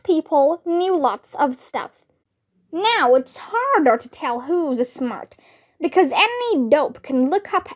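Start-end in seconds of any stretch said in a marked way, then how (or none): none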